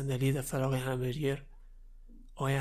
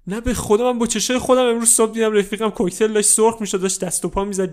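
high, loud distressed insisting; desperate swearing; 6 semitones higher, 9 dB louder than usual. Acted. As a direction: swear to god you saw it. Kid swears he saw it.

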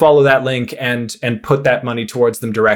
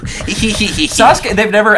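laughing; 9 semitones higher, 3 dB louder, much slower than usual. Real, not acted. Cracking up about Sasuke.

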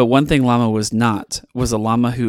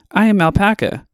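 higher, formal alpaca voice; Alpaca's voice; 9 semitones higher than usual. A narrator's reading. Alpaca answers Llama's call, in a high alpaca voice. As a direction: introduce yourself stiffly. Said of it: "answered Alpaca".

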